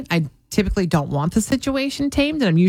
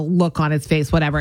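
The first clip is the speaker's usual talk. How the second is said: normal talking voice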